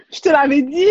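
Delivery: high, amused I-told-you-so; amused laughing protest. Real, not acted. Guest teased about her accent, laughing protest. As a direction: say it high, through real laughter.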